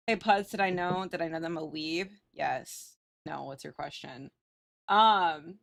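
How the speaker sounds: usual speaking voice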